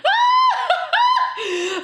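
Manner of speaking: high shrieking laugh. Real, not acted. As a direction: let out a big laughing shriek. Laughing at the long Zoom.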